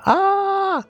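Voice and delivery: sudden high squeal of delight; excited squeal. Real, not acted. Excited outburst mid news.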